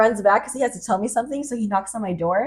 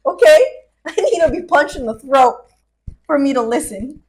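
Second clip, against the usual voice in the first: loud, raised insistence; emphatic rant; 4 semitones higher, 7 dB louder than usual. Real, not acted. Telling a friend she needs tough love, emphatic. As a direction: declare it in punchy, rising-volume bursts.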